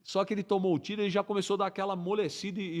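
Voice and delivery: ordinary delivery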